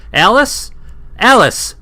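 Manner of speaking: high loud call across the bar. Acted. Calling the waitress Alice across the bar, loud and high.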